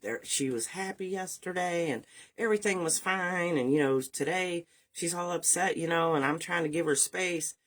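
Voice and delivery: high, puzzled husband voice; men's excuse. Retold or imitated. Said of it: "the men were like".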